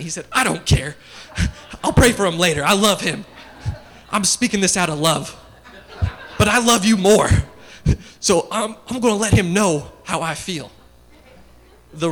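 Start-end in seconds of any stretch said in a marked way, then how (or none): 0.3-10.7 loud, laughing retelling of dad's defiant rant; imitating defiant dad. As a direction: boom out dad's stubborn reply, chuckling through it. Dad telling son off the coach.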